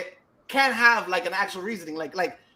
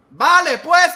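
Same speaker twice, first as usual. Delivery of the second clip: mock Spain Spanish accent, higher and louder; imitated Spain Spanish accent; 6 semitones higher, 6 dB louder, slower than usual. Friend who lost his Puerto Rican accent, mock Spain Spanish. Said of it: "all his fucking accent went out the window".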